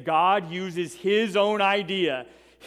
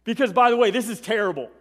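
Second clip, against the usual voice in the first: desperate Pharaoh; 5 semitones higher, faster than usual. Retold or imitated. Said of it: "He's literally asking Moses".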